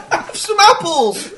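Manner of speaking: high, slow laughing yokel voice; mock West Country. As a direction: offer apples like a hammed-up Bristolian. Continuing Bristol impression.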